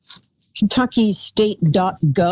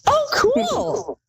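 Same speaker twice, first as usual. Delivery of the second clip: squeaky mock delight. Repeats squeak, caller laughs.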